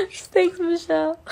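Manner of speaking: high-pitched thanks through a laugh; giggly thank-you. Real, not acted. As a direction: laugh it out, a little embarrassed. Thanking fan after name mix-up.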